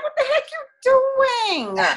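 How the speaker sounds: imitating a shocked sixteen-year-old; teen shocked mom quit her job, mock disbelief; incredulous teen. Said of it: "I was like".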